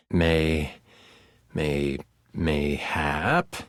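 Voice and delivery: very low, drawn-out musing; deep, slow, questioning character voice; low-voiced game figure. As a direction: stretch it out deep and slow, lifting at the end. Acted figure in game narration.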